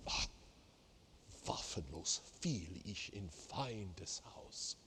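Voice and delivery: slow, soft half-whispered text; frustrated murmur. Shown as a demonstration. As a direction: speak it like a trapped man, under breath. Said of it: "It's, it's frustrating".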